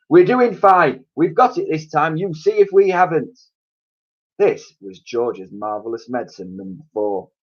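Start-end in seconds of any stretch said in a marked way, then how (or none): 0.1-3.4 higher, louder stubborn father; insistent father. A narrator's reading. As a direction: brush off the doubt. Mister Cranky voiced, insisting.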